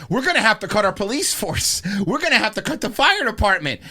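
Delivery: loud, high mock panic; mock panicking officials. Cities warning about the tax cut, in mock panic. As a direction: cry it out in exaggerated alarm. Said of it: "They're, like, literally saying stuff like".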